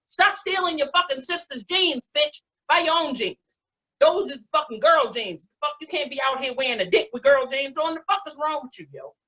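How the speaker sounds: high-pitched comic telling-off; mock scolding. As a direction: lay into them with exasperated disbelief. Scolds youngsters about their jeans.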